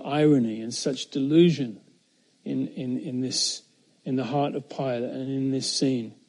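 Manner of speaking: normal talking voice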